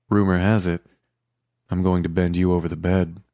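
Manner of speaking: plain reading voice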